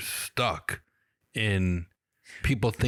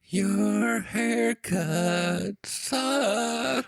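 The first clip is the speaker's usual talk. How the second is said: high, drawn-out singsong; sweetly chanted insult in a high voice; Positivity Fairy; 9 semitones higher, much slower than usual. Pretend. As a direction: trill it very slowly in a high fairy voice. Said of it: "You went from pretty vocally high".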